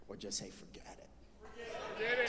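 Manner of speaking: whispered, slow and breathless. Retold or imitated. Uncle giving up on losing weight, slow whisper. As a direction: let it fall to a weary whisper.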